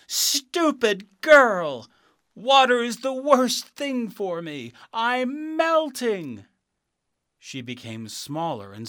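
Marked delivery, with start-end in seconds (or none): 0.1-6.5 high, loud, slow wailing witch voice; the witch wailing as she melts; melting witch. Acted. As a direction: shriek out her dying rage.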